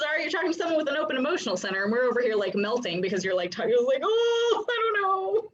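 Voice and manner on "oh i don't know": mock whimper. Mock overwhelmed open center.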